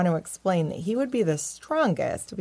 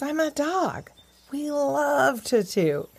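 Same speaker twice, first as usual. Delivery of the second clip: high, drawn-out delight; tickled doggy glee; gleeful; 8 semitones higher, slower than usual. A narrator's reading. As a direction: stretch it out with relish, pitched up. Dog gleefully thinks about toots.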